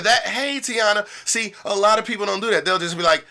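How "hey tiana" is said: warm greeting, name stressed. Shown as a demonstration. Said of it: "that right there".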